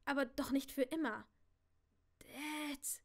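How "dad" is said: soft, strained croak; hoarse scratch. Acted. Girl with burning throat calls her father, hoarsely. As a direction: force out a hoarse little call. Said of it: "Meine Stimme glich nur einem heisernen Kratzen".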